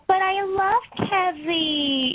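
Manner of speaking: slow, high Brooklyn brat pleading; Angelina's whine. Acted. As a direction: drag it out like a spoiled girl in love. Fans tease, she answers as Angelina.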